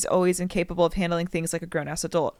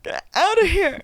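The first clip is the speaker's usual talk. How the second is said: high, feeble mock outburst; mock a weakling trying to throw someone out; hammed-up feeble high yell; limp-fisted mockery; 10 semitones higher, 4 dB louder than usual. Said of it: "has, like, limp fist being like".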